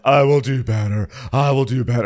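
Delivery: loud, high mock pledge; Marlo. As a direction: promise it with hammed-up fervour. Mimics Marlo's overwrought promise.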